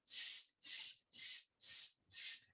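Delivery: a quiet unvoiced "s", held like a snake; soft hissed s. Shown as a demonstration. Teacher leading the class in the snake sound s. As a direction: breathe out a soft hissing "s". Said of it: "Can you all do the action and say the sound".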